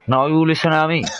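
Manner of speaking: put-on high, comic Welsh accent. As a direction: put on a hammed-up Welsh telling-off. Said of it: "when you do that Welsh accent".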